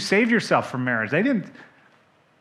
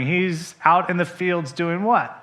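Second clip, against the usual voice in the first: usual speaking voice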